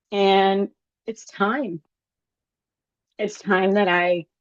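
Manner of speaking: slow, voice catching with emotion; struggling to get words out, moved; getting emotional. Real, not acted. Sharing goals with viewers, halting and emotional. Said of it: "I'm sorry I'm getting emotional".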